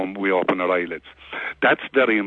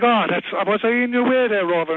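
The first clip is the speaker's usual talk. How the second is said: higher, overly polite owner's voice; fussy apologetic owner; 10 semitones higher than usual. Retold or imitated. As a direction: apologise to the dog with mock politeness.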